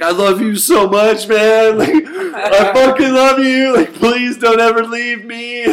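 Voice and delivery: high, loud gushing declaration with laughs; mock tearful pleading. Hugging best friend after nightmare, mock tearful pleading. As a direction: wail it clingingly, hammed up. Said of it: "I start bawling".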